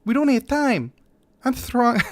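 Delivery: urgent lisp, pitched well up; lisping Reza voice. Acted. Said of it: "get the lisp out".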